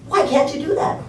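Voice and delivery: mock-angry wife challenging her husband. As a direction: pitch it up in mild annoyance. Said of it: "And she gets angry".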